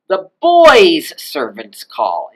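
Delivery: put-on "boys'" high, loud and long; slow line, punch up "boys'" then drop; high pitch on genitive. Shown as a demonstration. Teacher translating genitive-first order, "boys'" swooped high and loud. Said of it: "somersault up into this high pitch".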